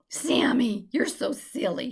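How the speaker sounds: ordinary delivery, nothing marked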